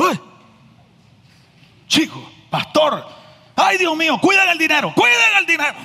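high, rising alarmed cry of a Cuban man. Retold or imitated. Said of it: "me dice". (cubano alarmado, cada vez más agudo)

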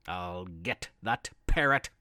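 low, growling pirate Pete; menacing pirate vow, low; gruff pirate voice. Acted.

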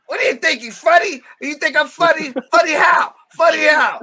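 high-pitched wiseguy taking offence; My Cousin Vinny. Acted. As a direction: do a hammed-up movie tough guy. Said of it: "I would use my cousin Vinny".

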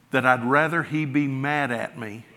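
ordinary delivery

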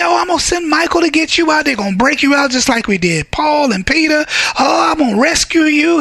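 high, quick, sing-song promise; mock rescuing Jesus. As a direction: ham up the easy rescue. Preacher mocks imagined comforting promise.

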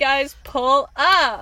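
drawn-out invite to pull up; hype voice. Pretend. Hyping a party invite.

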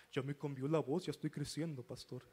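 low, mock-mature adolescent; hammed-up deepened teen voice; teen feigning grown-up voice. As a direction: put on a deep voice to seem grown. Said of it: "quieren sentirse acá".

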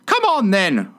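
high, screechy queenly roar; high-pitched imperious call; roared order. A narrator's reading. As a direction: bellow it high like an impatient queen. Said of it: "roared the Queen".

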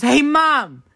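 high, slow tattletale friend voice; tattling friend. Retold or imitated.

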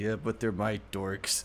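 low, slow and soft; slow, low fond grumble; Flying Dutchman voice. Retold or imitated. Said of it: "And he".

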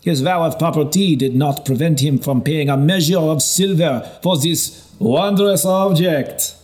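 king mocking the bishop at dinner. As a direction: tease the bishop before the court. Karl mocks the bishop at feast.

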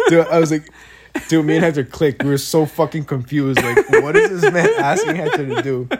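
real laughter breaking through the words; laughing while telling. Real, not acted. Teller cracks up retelling awkward moment.

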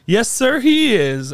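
high, loud affirmation; retold emphatic agreement. Woman agreeing that God is good, retold with loud, high fervour. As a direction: imitate a fervent church-lady amen.

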